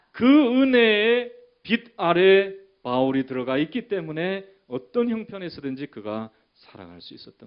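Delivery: high, loud cry easing off gradually; impassioned preaching. Real, not acted. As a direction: launch in at full pulpit force, then settle. Preaches climax after the declaration.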